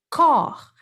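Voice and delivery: corps said short and clean, silent final s, like kor; model pronunciation. Shown as a demonstration. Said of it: "mas a gente fala".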